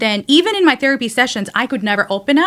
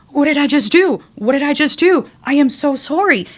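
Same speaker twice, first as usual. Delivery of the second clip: retold anguish; 5 semitones higher than usual. Retelling anguished prayer walking home.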